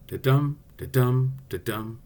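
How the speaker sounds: "da-dum" with light da and strong DUM, evenly spaced; pulsing da-DUM, pair by pair; iambic beat. Shown as a demonstration. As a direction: beat out each "da-dum" with the accent on dum. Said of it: "So it's".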